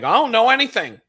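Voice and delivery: high, goofy dumb voice; high mock simpleton. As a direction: play it empty-headed.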